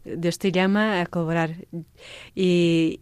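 normal talking voice

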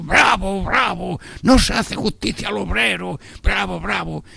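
high mock cheering of a congregation; crowd cheering. Congregation echoing the preacher, mock cheer. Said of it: "y todo el mundo".

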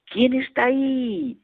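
high, slow call into the mountains; child calling out who's there; shouted question. Retold or imitated. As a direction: call out to the hills. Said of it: "grité".

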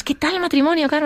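high, chirpy question; curious coworkers. Retold or imitated. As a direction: ask brightly, like nosy colleagues. Coworkers ask about the marriage. (compañeras curiosas, voz aguda)

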